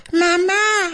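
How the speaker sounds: very high mock child squeak; helium kid voice. Mocking an annoying dubbed child, helium squeak. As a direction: imitate an adult faking a little kid. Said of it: "inhaled some helium".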